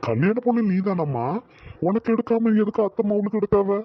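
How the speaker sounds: high-pitched, put-out challenge; affronted, voice climbing high; indignant questioning. Acted. Challenging the bride over the saree.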